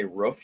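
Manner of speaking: "roof" with a short vowel like a woof; teasing short-vowel "roof"; mimicked pronunciation. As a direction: say "roof" like a dog noise. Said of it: "the noise I make at my dog".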